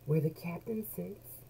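ordinary delivery

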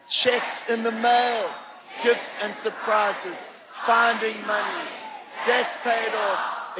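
slow, emphatic congregational recitation; loud group declaration. Real, not acted. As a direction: lead the church in a loud read-aloud, phrase by phrase. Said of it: "say it as loud as you can".

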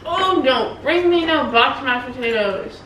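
usual speaking voice

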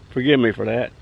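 ordinary delivery

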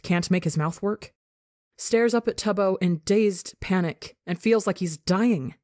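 ordinary delivery, nothing marked

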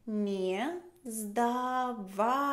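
drawn out word by word, very slowly; slow it right down so the learner hears each sound; very slow, spelled out. Shown as a demonstration. (muy despacio, palabra por palabra)

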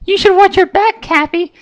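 very high, taunting pony voice; maniacal Pinkie. A narrator's reading. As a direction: tease him with a mad edge. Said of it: "Pinkie giggled maniacally".

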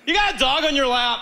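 high-pitched mock jab; acted taunt. Comic to woman with dog on lap, high mock taunt. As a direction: toss it across high, as a taunt. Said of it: "so I go".

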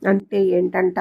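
usual speaking voice

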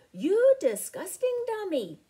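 high, loud roar at the foe. A narrator's reading. Dinarella roaring at the Deinonychus from the dune, loud. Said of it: "she roared".

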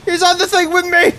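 high, loud triumphant yell; excited yelling. Real, not acted. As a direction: roar it like a war cry. Boss battle turns wild.

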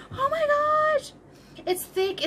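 high gasping delight. Real, not acted. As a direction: gasp with joy. Thrilled by the yarn.

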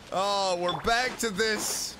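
ordinary talk, nothing marked

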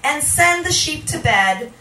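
loud, driving spoken word over music; spoken-word performance. Acted. Poet addressing the crowd, loud spoken word. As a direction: push the lines out like an incantation.

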